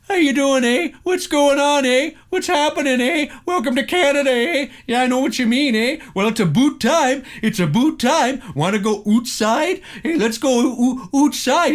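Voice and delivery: put-on high, loud caricature of a Canadian accent. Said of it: "we are always portrayed like this".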